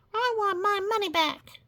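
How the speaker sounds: high, whiny complaining heckler. Acted. An unhappy audience member demands his money back, high and whiny. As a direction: demand a refund in a high put-on voice.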